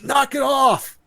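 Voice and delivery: high, loud mock shout; imitated leader yelling for order; commanding shout. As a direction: call a halt to the squabbling. Acts out leader stopping the teasing.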